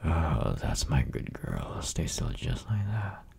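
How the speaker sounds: put-on breathy close-mic whisper to a girlfriend; teasing boyfriend whisper, close and intimate. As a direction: whisper it right into her ear.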